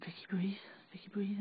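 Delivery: szept, łagodnie uspokajający; szepcz, jakbyś uspokajał kogoś w panice; urgent whisper. Retold or imitated. Mikhail calming her, retold.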